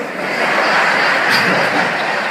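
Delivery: breathy burst of laughter; a real airy laugh. Real, not acted. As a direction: laugh out loud at the joke. Laughs at his own story.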